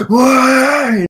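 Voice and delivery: very high, loud shocked cry; astonished crowd. Acted. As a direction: blurt it out as a stunned, high-pitched yell. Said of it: "they look at your face and they're like".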